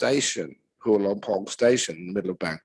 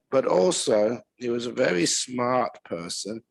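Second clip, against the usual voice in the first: usual speaking voice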